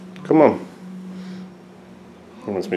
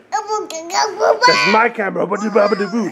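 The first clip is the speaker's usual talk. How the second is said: put-on baby voice; 10 semitones higher, much slower than usual. Squeaky high mock voice.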